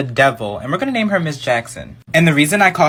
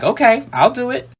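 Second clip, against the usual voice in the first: put-on child voice; 9 semitones higher than usual. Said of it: "I was like".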